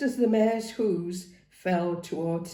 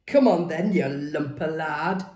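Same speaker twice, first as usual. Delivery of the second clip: shouted dare, rising; loud taunt; 3 semitones lower, 6 dB louder than usual. A narrator's reading. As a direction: call him on, loudly. Taunting in a fight.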